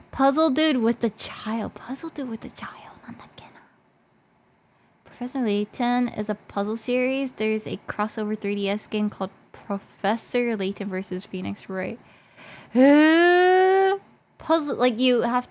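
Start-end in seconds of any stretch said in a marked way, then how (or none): 1.7-2.8 whispered to herself. Real, not acted. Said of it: "repeated in a whisper". 3.0-3.6 whisper. Real, not acted. Musing to herself. 12.7-14.0 sharp loud gasp; surprised gasp. Real, not acted. Reacts to crossover news.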